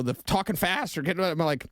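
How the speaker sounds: ordinary talk, nothing marked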